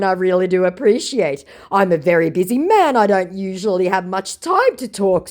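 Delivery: squeaky fake famous man boasting; hammed-up scammer voice. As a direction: play the fake celebrity puffing himself up. Scammer's long reply voiced.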